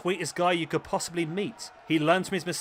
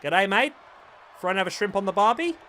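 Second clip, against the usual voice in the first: loud, hammed-up Australian accent, higher and louder; 4 semitones higher, 4 dB louder than usual. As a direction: play the cheerful Aussie bloke with an Australian accent. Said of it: "I thought my accents are pretty good".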